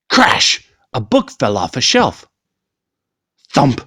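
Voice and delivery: plain reading voice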